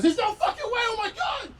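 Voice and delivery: very high, frantic protest; panicked disbelief. Retold or imitated. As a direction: blurt it out in hammed-up horror. Acts out his shocked reply to charge.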